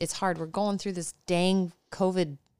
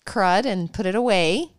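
normal talking voice

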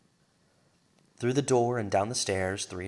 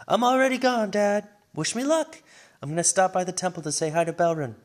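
put-on high, bright-eyed girl rushing out; a young girl already halfway out the door; excited teen heroine, fast and high; eager young girl; 10 semitones higher, 8 dB louder than usual. Foria's eager voice.

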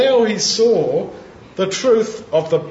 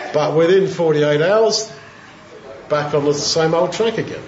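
normal talking voice

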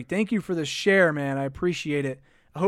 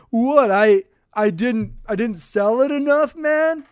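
higher, louder, put-on protest; hammed-up wounded disbelief, pitched high and loud; mock indignant player; 4 semitones higher, 6 dB louder, slower than usual. Host mimicking a flopping player's complaint, mock indignant. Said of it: "three different accents at once".

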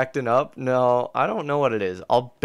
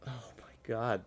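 frustrated whisper; 12 dB quieter than usual. Real, not acted. VR headset frozen again.

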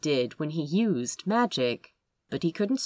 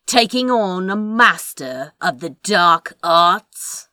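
attempted intimidation; 6 dB louder than usual. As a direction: try to sound frightening and fail a little. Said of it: "trying for intimidation".

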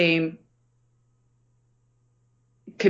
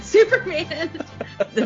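laughing; 11 semitones higher than usual. Real, not acted. Laughing correction after slip.

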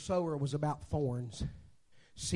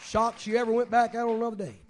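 raised, louder preaching story voice; lively sermon storytelling; 9 semitones higher, 7 dB louder than usual. Acted. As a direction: tell the car story to the congregation with full force. Preacher telling a story with force.

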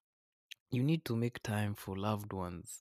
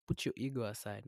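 normal talking voice

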